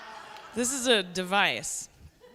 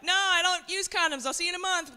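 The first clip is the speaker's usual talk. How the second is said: higher-pitched, lightly acted brush-off; mock breezy refusal; 8 semitones higher than usual. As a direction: pitch it up for a pretend polite no. Acts out a reply she can't give.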